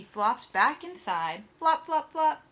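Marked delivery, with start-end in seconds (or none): none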